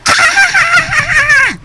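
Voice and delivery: sudden high scream of fright; short high startled cry; startled shriek. Acted. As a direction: shriek as if jolted awake. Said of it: "all of a sudden she goes".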